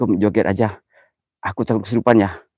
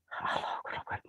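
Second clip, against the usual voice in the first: hushed, breathless takbir; frightened whisper of Allahu Akbar; fearful whispered prayer; 24 dB quieter than usual. Real, not acted. Praying in fear at haunted spot.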